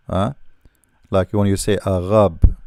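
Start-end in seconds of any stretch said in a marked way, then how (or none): 1.8-2.6 arabe with a throaty French r; French pronunciation. Shown as a demonstration. Teacher cites how the French say it, French pronunciation. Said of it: "the French, they say arabe".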